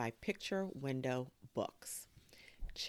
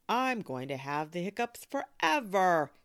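loud, higher dramatic complaint; exasperated wail; 5 semitones higher, 8 dB louder than usual. Acted. Boy complaining hiccups will never stop, loud exasperated wail. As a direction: wail it, swelling on the last word.

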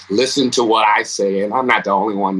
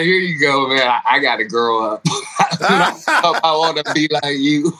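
laughing handback; 4 semitones higher than usual. Retold or imitated. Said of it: "I'm like".

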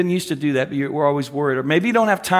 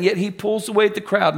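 ordinary talk, nothing marked

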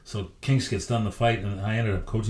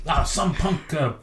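high, raised voice of an angry governor; irate governor; 9 semitones higher than usual. Retold or imitated. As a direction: play the fuming team boss, pitched up. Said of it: "He said".

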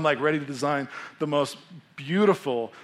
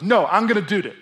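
put-on high, loud toddler insistence; daughter's voice; 5 semitones higher, 8 dB louder than usual. Dad imitating his toddler pushing him away, high and loud. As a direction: push back like a stubborn toddler. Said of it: "she pushes me away and she goes".

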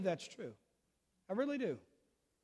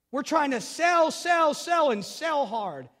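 mock hard sell; 8 semitones higher, 9 dB louder, much slower than usual. Mocking pushy church salesmanship.

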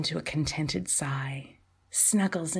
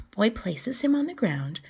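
ordinary delivery, nothing marked

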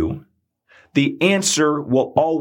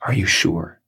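heart whispering. Retold or imitated. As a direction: barely voice it, gently asking. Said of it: "It's gonna whisper".

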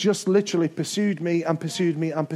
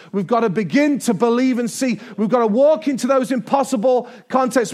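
building up; 5 semitones higher, 4 dB louder than usual. Real, not acted. Preacher building to climax.

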